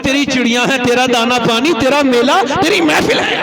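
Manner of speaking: loud, rising preacher's cry; fervent preaching. Acted. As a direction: proclaim it to the crowd at full force. Preaching at full force.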